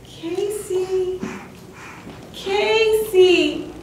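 high, drawn-out call; distant calling voice. A narrator's reading. Mother searching for her son in the night, high drawn-out calling. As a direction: call a name long and high, as from far off. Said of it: "through the night came a voice".

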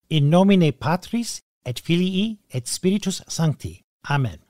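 steady, devout recitation of the prayer. Acted. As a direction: pray it evenly, as at Mass. Recites Latin sign of cross.

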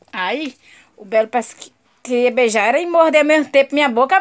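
ordinary talk

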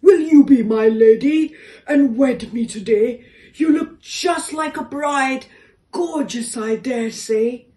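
loud, laughing bold proposal; brave proposal. A narrator's reading. Door proposing to the elephant, bold and laughing. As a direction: blurt it out with nervous courage. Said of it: "the door bravely said".

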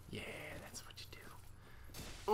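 a breathless hushed whisper to himself. Real, not acted. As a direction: breathe it out under your breath, slow. Coaching himself in boss fight.

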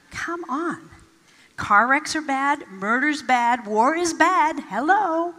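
mock-exasperated, pushing each point a little harder; scoffing inner voice. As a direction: scoff at the idea with a sarcastic Hello. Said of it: "inside myself".

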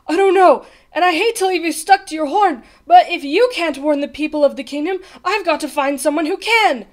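urgent apology. A narrator's reading. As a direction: explain urgently before rushing off. Bartholomew urgently leaving stuck trumpeter.

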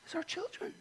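soft, high-pitched hush; tender hush. Real, not acted. Preacher naming God's most precious gift, tender and hushed. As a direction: lift the pitch and drop the volume, tenderly.

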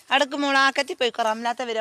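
high, loud call to her daughter. Real, not acted. Mother calls out to her daughter walking ahead, loudly. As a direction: raise the voice so she hears from afar.

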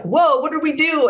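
high, startled group reaction; staff's mock alarm. Retelling how the office reacted, mock alarm. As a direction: imitate stunned coworkers, voice jumping up. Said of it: "they're all like".

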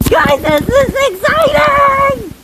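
high, stretched-out excited cry to the viewers. Real, not acted. Kid telling viewers about the snow, excited. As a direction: squeal it out, drawing the words long.

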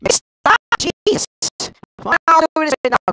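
sudden high yelp of alarm; jump-scare shriek; startled shout. Real, not acted. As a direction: yell out in real fright.

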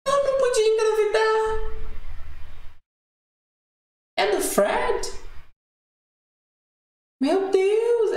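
high, shrieking disbelief; stunned disbelief. Real, not acted. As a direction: shriek questions you can't believe. Stunned by pregnancy reveal.

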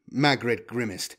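plain reading voice

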